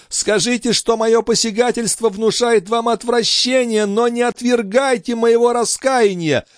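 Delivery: high, anguished begging; desperate, impassioned confession; heartrending sobbing plea. A narrator's reading. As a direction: beg forgiveness in a tormented high voice. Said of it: "с душераздирающими рыданиями продолжал Жильбер".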